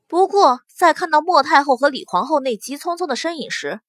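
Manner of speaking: plain reading voice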